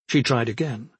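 ordinary delivery

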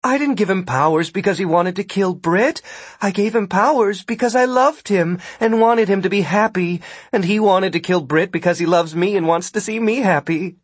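high-pitched, insistent protest; indignant defence. A narrator's reading. Ida defends giving her lover powers, indignant. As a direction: justify yourself, affronted.

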